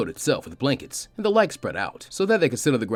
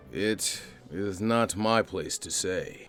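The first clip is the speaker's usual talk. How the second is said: serious father; 3 semitones lower, 6 dB quieter, much slower than usual. Acted. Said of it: "responded with a serious expression".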